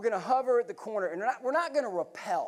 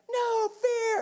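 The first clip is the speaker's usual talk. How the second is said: "No fear" yelled much higher and louder; retold yell; 5 dB louder than usual. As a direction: yell "No fear" like the crew chief over gunfire. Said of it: "that's what Ned's yelling".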